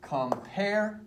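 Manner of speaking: "Compare" split, with "pare" held high; iambic stress. Shown as a demonstration. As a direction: stretch and lift the second syllable of "Compare". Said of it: "the accent is on the second syllable".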